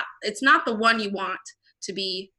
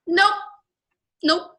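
higher and louder, firm and insistent; loud, pitched-up insistence; emphatic loud rebuttal; 6 semitones higher, 7 dB louder than usual. Real, not acted. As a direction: shut the excuse down hard.

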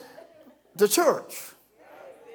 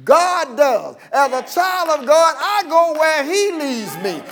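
raised, insistent preacher's delivery; full-force preaching; 6 semitones higher, 4 dB louder than usual. Real, not acted. Preacher tells believers God decides, forceful preaching. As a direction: press each phrase on the congregation.

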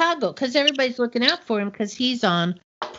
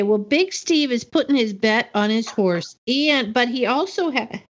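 ordinary talk, nothing marked

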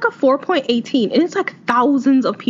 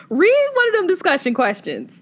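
sassy disbelief; 8 semitones higher than usual. Real, not acted. Incredulous reaction to book.

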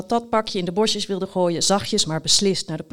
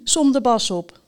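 ordinary delivery

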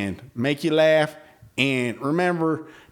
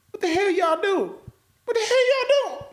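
much higher alien squeak. Pretend. Imagining aliens scolding humans, a high squeaky alien voice. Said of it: "I don't know if they talk like that".

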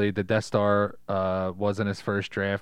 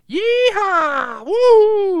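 very high, loud cowboy whoop; whooping rescuer; 12 dB louder, much slower than usual. Acted. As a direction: holler it like a flyboy swooping in. Acts pilot swooping to rescue.

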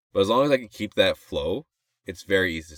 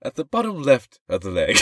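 slightly louder, higher documentary narrator; 5 semitones higher, 4 dB louder than usual. Acted. Narrating a nature episode, hammy narrator voice. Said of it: "I'm doing the voice now".

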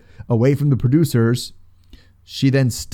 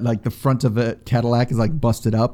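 ordinary talk

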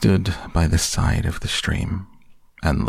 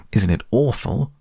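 higher, mildly fearful character voice; lightly voiced fright, pitched up; a hint of dread, seeking comfort; frightened Piglet; 5 semitones higher than usual. A narrator's reading. Piglet clutching his friend in fright.

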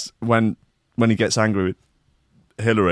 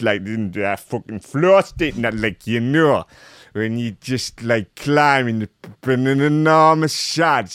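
put-on voice for a quoted stuntman; young climber; 3 semitones higher, 3 dB louder than usual. Host acting out the climber's interview, put-on voice. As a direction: start high and loud, then ramble on in character. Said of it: "George said".

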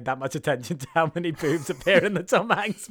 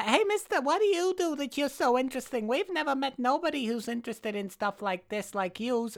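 imitating the strippers; 9 semitones higher, 6 dB quieter than usual. Mimicking the strippers flirting with the congressman, high put-on voice. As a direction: pitch it way up like a ditzy stripper. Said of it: "this is pretty much how they do speak".

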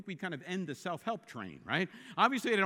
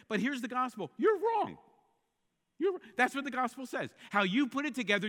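louder, higher, each you're wrong punched; blunt verdict; 6 semitones higher, 5 dB louder than usual. Pretend. Preacher voicing the gospel telling listeners they're wrong, loud. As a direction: slam down every verdict.

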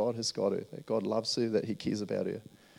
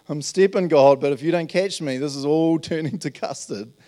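louder, higher half-panicked plea; mock panic praying to God; panicky prayer; 5 semitones higher, 8 dB louder than usual. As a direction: blurt it like stepping off a cliff. Nervous prayer stepping out.

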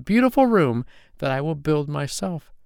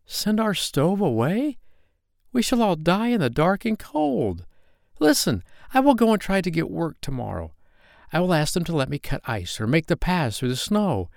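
put-on high-pitched, desperate little boy; impassioned plea, pitched well above narration; crying out, pleading; 8 semitones higher than usual. A narrator's reading. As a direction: beg urgently in a child's raised voice. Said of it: "cried poor little Carl".

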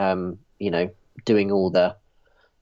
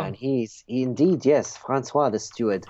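put-on French accent. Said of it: "your French accent".

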